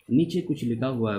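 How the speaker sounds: ordinary delivery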